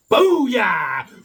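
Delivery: loud whooping cheer; exuberant shout. Real, not acted. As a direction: shout it like a victory cry.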